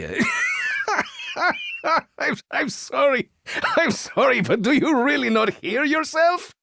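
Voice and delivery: real giggles breaking through the words; cracking up, can barely get it out; laughing. Real, not acted. Actor breaks character laughing at the recording.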